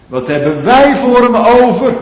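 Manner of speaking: high, loud accusing question; rhetorical challenge. Acted. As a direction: throw the question at the hearers. (hoog en luid uitdagend gevraagd)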